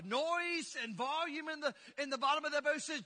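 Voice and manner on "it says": ordinary talk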